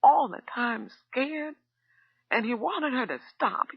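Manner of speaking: a mild sob through the words; sobbing. A narrator's reading. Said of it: "hardly audible under the sobbing".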